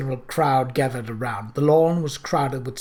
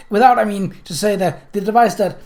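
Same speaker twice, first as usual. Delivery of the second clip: loud, high words forced out between coughs; coughing fit; 7 semitones higher, 8 dB louder than usual. Acted. Journalist tries to explain to Kate through a coughing fit. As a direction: struggle to talk through coughing. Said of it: "seized by a fit of wild coughing".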